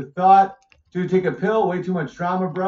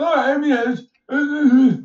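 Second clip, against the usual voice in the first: high, louder mock stammer of a cornered debater; mock flustered stammer; 7 semitones higher, 4 dB louder than usual. Streamer mocking a chat debater caught out, flustered stammer. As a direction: play the opponent fumbling for words. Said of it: "You would say".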